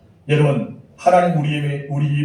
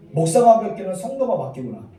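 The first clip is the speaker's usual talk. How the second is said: normal talking voice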